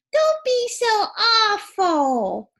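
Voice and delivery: high, loud, slow scolding; Annie Apple. Acted. Teacher voicing a character's example sentence, high drawn-out scolding. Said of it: "what Annie Apple calls Walter Walrus".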